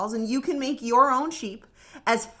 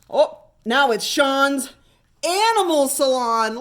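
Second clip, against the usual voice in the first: plain reading voice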